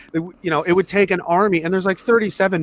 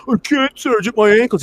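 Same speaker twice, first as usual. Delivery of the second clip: higher mock whine; whining; 5 semitones higher, 3 dB louder than usual. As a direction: imitate a whiny soldier pleading helplessly. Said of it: "he's, like, whining".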